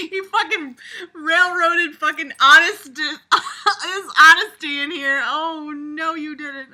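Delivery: high, loud laughing through the words; real laughter. Real, not acted. Laughing at the teacher in the story, real laughter. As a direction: crack up while you get it out.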